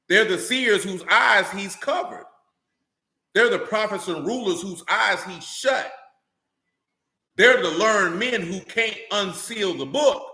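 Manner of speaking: slow, weighty pulpit delivery, every phrase landed; slow preaching. Acted. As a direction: preach it slowly, letting each line sink in.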